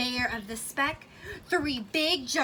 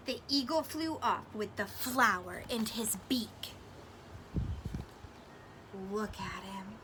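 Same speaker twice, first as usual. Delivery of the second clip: put-on hushed whisper to the children; a soft storytime whisper; 4 semitones lower, 8 dB quieter than usual. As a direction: build suspense in a whisper.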